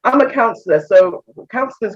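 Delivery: normal talking voice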